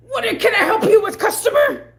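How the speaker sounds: high, loud, gushing greeting; over-eager service voice. Retold or imitated. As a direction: greet the customer way too eagerly. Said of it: "That's exactly how he wants".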